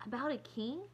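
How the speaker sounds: dubious. A narrator's reading. Kirsty asking Mama about the promised story, dubious. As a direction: ask it warily, lifting at the end. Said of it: "Kirsty asked dubiously".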